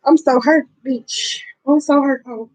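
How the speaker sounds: worked-up, wounded complaint; hurt. Real, not acted. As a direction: wail lightly about how hurt you are. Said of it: "I'm hurt".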